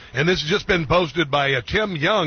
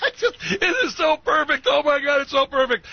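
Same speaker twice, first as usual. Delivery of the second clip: talking through high giggles; can barely get the words out for laughing; 10 semitones higher than usual. Real, not acted. Host giggling at a clip he finds hilarious, laughing.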